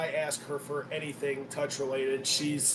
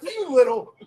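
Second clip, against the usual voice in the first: loud, higher, teasing outburst; mock indignation; 9 semitones higher, 9 dB louder than usual. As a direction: burst out in playful outrage at your son. Mock outrage at wife's teasing.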